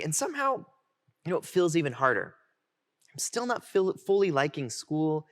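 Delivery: normal talking voice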